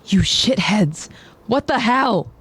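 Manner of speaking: angry. Acted. Annie voiced angrily.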